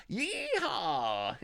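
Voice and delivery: a light mock Western whoop; hint of a Western drawl on yeehaw; cowboy yeehaw. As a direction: toss off a playful cowboy yeehaw. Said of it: "I can say yeehaw".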